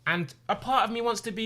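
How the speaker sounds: usual speaking voice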